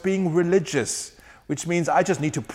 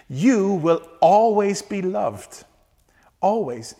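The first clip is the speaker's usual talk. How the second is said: tender whisper; 3 semitones higher, slower than usual. Real, not acted. Tender climax of sermon.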